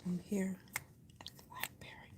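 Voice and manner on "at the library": hushed, barely voiced whisper; library whisper. Real, not acted. Vlogger telling viewers she's at the library, whispering. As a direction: whisper it softly so nobody is disturbed.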